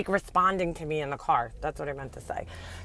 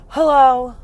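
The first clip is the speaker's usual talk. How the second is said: mock brother answering the phone, short and irritated, a bit louder; curt annoyed hello; 4 semitones higher, 7 dB louder than usual. Brother picking up his girlfriend's call, curt and annoyed. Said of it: "I know my brother sounds like".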